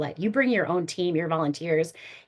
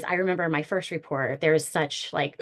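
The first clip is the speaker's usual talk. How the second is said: ordinary delivery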